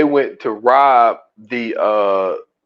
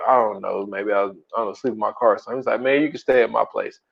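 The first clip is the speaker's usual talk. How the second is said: hushed exchange; 4 semitones lower, 8 dB quieter than usual. Retold or imitated. Quiet heartfelt exchange.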